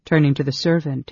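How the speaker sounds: ordinary delivery, nothing marked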